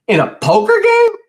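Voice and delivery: very high, loud incredulous shriek; shocked disbelief. A narrator's reading. Brother hearing wages lost at poker, shocked disbelief. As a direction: squawk it in outraged disbelief. Eugene shocked at gambling confession.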